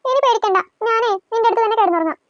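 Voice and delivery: normal talking voice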